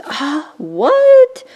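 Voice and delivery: high, loud, stretched surprised "what"; astonished delight. Real, not acted. Finding unexpected stamps in the gift, astonished. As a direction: gasp it out in amazement.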